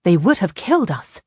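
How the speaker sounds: loud, forceful justification; fierce, emphatic statement. A narrator's reading. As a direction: hit it hard and fiercely. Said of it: "Ishrak said fiercely".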